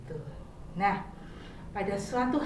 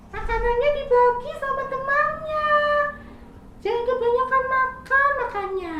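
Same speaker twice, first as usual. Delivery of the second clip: much higher, louder, gently scolding little squirrel; 12 semitones higher, 5 dB louder than usual. Acted. Squirrel gently scolding greedy caterpillar, high chiding voice. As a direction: tell off a greedy child kindly.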